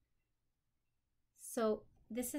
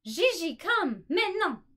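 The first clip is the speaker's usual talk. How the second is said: calling out; 8 semitones higher, 13 dB louder than usual. Acted. Mother calls child home.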